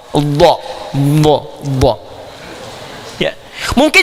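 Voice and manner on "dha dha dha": "Dha" repeated with a clear emphatic Arabic dh; Arabic letter dha. Shown as a demonstration. Teacher demonstrating the unique Arabic letter to students. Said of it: "yaitu huruf dha".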